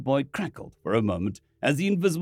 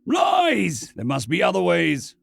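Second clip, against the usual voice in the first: loud high bellow; 5 semitones higher, 4 dB louder, slower than usual. Acted. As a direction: bellow the denial. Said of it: "Ronson bellowed".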